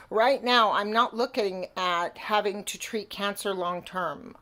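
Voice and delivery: normal talking voice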